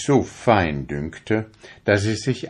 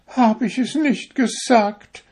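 a high needle sighing; mild sigh; 3 dB louder than usual. A narrator's reading. Broken needle to the fingers, mildly sighing. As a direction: sigh it out, a touch self-pitying. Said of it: "seufzte die Stopfnadel".

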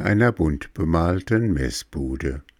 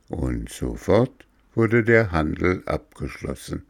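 plain reading voice

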